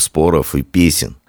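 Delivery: usual speaking voice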